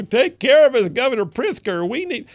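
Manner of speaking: high, loud whiny plea to the governor; mock plea. Host mocking Democrats begging Governor Pritzker, whiny high plea. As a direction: ham up a helpless plea for rescue.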